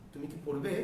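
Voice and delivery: soft, brief কি, question rising on পড়বে; short কি. Shown as a demonstration. Teacher showing the shortcut question, short কি. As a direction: make কি a quick short vowel, lift পড়বে at the end. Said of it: "shortcut".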